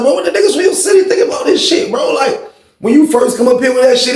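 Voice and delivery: amped-up prying question; worked-up question. Retold or imitated. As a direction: press him hard for the dirt. Repeating pushy question to friend.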